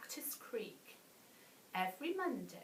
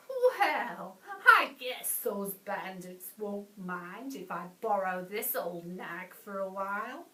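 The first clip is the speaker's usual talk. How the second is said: grinning bandit; 7 dB louder than usual. A narrator's reading.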